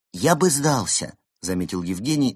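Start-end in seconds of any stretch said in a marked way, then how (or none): 0.1-1.2 slow, wistful suggestion; without much hope. A narrator's reading. Penguin wishing they would give up, without much hope. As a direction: venture it slowly, expecting no agreement. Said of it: "но без особой надежды".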